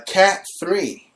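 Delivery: slow, drawn-out announcer on Cat three; dramatic news read. Acted. Host reading trending hurricane news, slow mock announcer. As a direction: stretch Cat three like a breaking-news tease.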